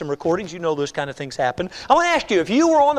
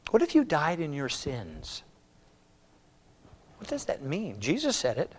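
hushed earnest preaching; 4 semitones lower than usual. Acted. Preacher drops to a hushed plea.

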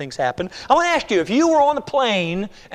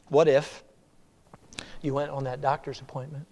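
hushed earnest preaching; 4 semitones lower than usual. Acted. Preacher drops to a hushed plea.

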